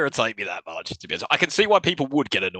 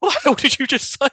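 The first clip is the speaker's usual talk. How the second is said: high, louder retold challenge; mock outrage; 12 semitones higher, 6 dB louder than usual. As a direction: snap back in mock offence. Barman's angry reply to offensive greeting.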